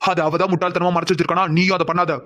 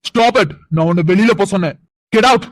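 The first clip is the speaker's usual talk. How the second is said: furious shouting; 3 semitones higher, 6 dB louder, slower than usual. Acted. Uncle yells at the girl to leave, furious. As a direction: shout her down and out. Ordering her out.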